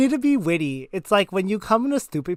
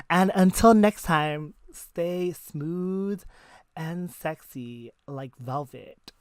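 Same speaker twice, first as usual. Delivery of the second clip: smooth sign-off; much slower than usual. Pretend. Podcast sign-off, meant smooth and sexy.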